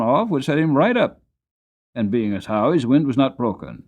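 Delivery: loud, fast, rustic seller's pitch; a dealer talking up a tired horse; eager horse dealer. Acted. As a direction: seller pushes the sale to the gentleman, loud and rustic. Horse seller makes his pitch.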